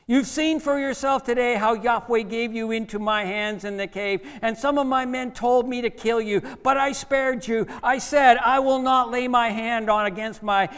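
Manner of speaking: loud, high impassioned appeal; David. Acted. David pleading with King Saul from the cave mouth, calling out. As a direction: call out your defence across a distance. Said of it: "what David actually said to King Saul from the mouth".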